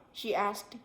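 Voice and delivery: plain reading voice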